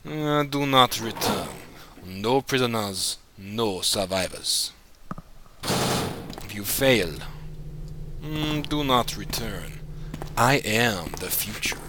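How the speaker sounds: Russian accent. Shown as a demonstration. Player showing viewers his few words, Russian accent. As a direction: intone villain catchphrases in a Russian accent. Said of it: "I can do my Russian accents better".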